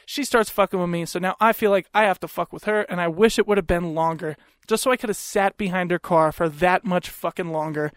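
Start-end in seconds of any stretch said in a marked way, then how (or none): none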